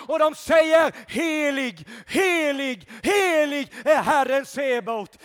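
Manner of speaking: loud, rising sermon delivery, building to shouts; fiery preaching. Acted. Preacher rousing the church, shouting praise. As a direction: preach it at full force to the congregation.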